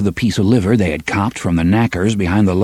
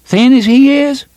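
high, louder mocking boy; scoffing boy; 5 dB louder than usual. First boy scoffing at the bet, high and loud. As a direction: scoff at the very idea.

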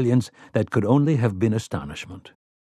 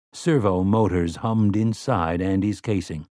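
ordinary talk, nothing marked

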